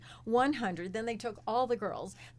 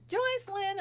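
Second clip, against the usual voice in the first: calling out name; 11 semitones higher than usual. Retold or imitated. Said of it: "the director goes".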